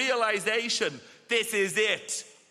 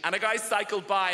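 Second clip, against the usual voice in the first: ordinary talk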